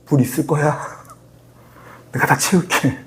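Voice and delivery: hushed, half-whispered playful assurance; mock whispered promise. God promising water in vague terms, mock whisper. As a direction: murmur it low, like a vague hint.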